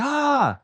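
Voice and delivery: high mock scream of a lady; bystander's scream, lightly imitated; retold scream. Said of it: "some lady started screaming".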